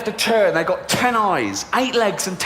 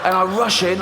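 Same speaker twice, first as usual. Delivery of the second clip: ordinary talk, nothing marked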